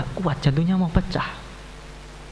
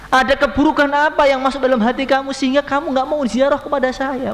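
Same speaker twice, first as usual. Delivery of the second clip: high, loud longing reproach; the Prophet pleading; 10 semitones higher, 3 dB louder than usual. Acted. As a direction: ask why he stays away, voice raised and aching. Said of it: "Beliau mengatakan". (Nabi menegur Bilal dengan rindu, suara tinggi dan keras)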